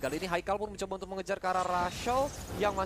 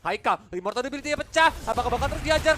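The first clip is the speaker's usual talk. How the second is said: rising hype, louder and higher; excited; 8 semitones higher, 10 dB louder than usual. Real, not acted. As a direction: call the fight with building excitement. Casting a teamfight breaking open.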